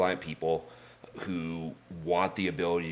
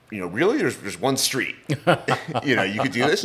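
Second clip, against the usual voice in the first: incredulous laughing; 7 semitones higher, 4 dB louder than usual. Retold or imitated. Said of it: "And I was like".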